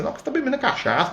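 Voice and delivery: teasing high sing-song jab; mocking aside. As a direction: toss it off high and quick in mockery. Mocking the spirit's preference.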